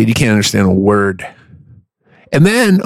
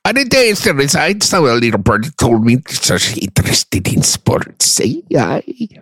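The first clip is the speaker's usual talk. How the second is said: thick, swooping mock Scots; exaggerated Scottish impression; Scottish accent; 3 semitones higher than usual. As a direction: ramble on in broad, garbled Scottish. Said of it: "let me hear your Scottish accent".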